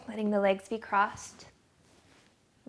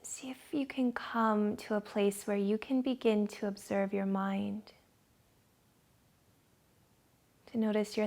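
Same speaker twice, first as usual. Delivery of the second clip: guided meditation; 6 dB quieter than usual. Acted. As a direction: ease them into calm, dropping to a whisper on the breath.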